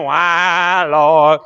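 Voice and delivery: drawn-out mock wail to God; hammed-up whine; whining complaint. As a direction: imitate a grumbler moaning in prayer. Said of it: "I'm murmuring and complaining".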